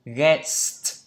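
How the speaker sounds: "guest" with a clear s before the t; "guest" with a hissed s, then t; audible S. Shown as a demonstration. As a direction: sound out the st ending of "guest". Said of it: "có S các bạn nhé".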